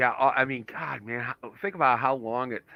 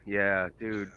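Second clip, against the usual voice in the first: ordinary talk, nothing marked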